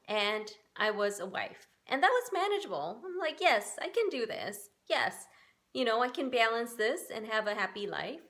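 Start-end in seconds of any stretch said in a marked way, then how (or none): none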